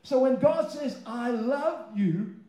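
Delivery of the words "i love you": normal talking voice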